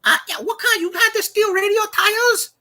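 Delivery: very high, halting put-on caller; stammering prank-call voice; Waterboy. Shown as a demonstration. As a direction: disguise your voice as a goofy high-pitched customer. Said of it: "You sound like Waterboy".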